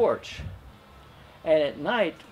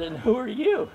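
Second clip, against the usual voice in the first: laughing; 7 semitones higher than usual. Real, not acted. Retold greeting, laughing through.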